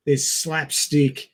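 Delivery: ordinary talk, nothing marked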